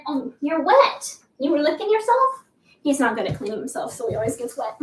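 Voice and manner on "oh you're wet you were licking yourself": sweet high voice for a pet; pet talk. Real, not acted. Talking to the wet cat.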